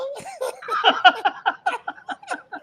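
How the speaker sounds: a loud, high burst of laughter; laughing hard at his own bad joke; big laugh. Real, not acted. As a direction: crack up at your own punchline.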